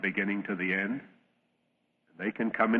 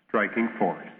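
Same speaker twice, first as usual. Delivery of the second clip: ordinary talk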